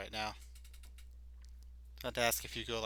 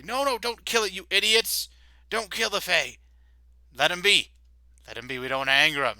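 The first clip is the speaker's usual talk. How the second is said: high, loud angry scolding; scolding guard; 8 semitones higher, 9 dB louder than usual. Acted. Guard scolding his comrades not to kill the fae. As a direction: stop the idiots before they act.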